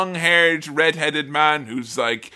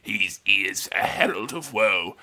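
sneaky Grima; 7 semitones lower, 6 dB quieter than usual. Retold or imitated. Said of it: "Grima is like".

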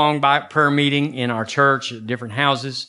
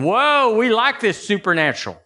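mock delight; 7 semitones higher than usual. Voicing Christians in the wilderness.

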